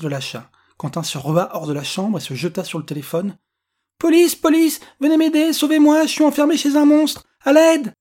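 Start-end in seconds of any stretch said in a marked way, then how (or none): 4.0-7.9 put-on high, loud terrified child on the phone; panicked cry for help. A narrator's reading. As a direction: yell for rescue in a high child's voice. L'enfant terrifié appelle la police. (appel au secours paniqué, aigu et fort)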